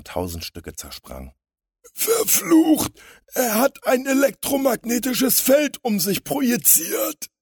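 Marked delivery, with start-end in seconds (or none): 1.9-7.3 very high, loud wail; whimpering villain. A narrator's reading. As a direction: whine it like a wounded crook. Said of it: "winselte der Große". (winselnd und jaulend)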